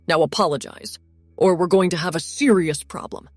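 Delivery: loud, fierce protective warning; hard-edged threat, loud. Acted. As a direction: stand up for your sister. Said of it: "look so fierce".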